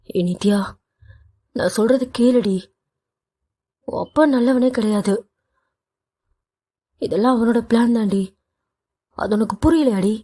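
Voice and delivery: a touch of tremble, begging her to understand; trembling voice. Acted. Husband begging his wife to see the truth, mildly trembling voice. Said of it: "Varun நித்யாவை பார்த்து குரல் நடுங்க".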